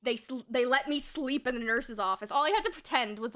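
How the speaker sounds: usual speaking voice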